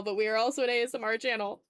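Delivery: ordinary talk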